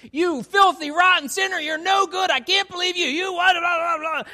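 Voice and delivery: high, loud mock tirade at a child; lashing out. Parent lashing out at daughter Sally, mock furious shout. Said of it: "lash out and say".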